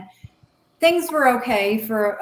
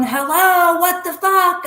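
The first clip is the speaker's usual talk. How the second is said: high, loud yell into the phone; screaming into phone; 8 semitones higher, 4 dB louder, slower than usual. Retold or imitated. Angry caller screaming at a pocket-dialing housemate. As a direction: yell down the line in shock. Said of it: "I'm screaming into the phone".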